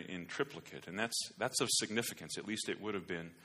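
usual speaking voice

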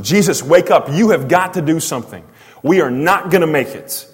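loud, high desperate plea. Acted. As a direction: beg urgently for rescue. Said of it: "a lot of desperation".

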